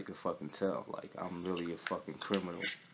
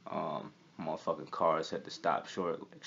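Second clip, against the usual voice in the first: ordinary talk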